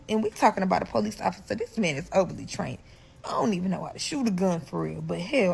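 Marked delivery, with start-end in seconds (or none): none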